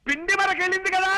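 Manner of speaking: high, projected announcing; strong voice. Acted. Son-in-law announcing a match to mother-in-law, strong voice. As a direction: call the news out for deaf ears. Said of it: "స్ట్రాంగా?".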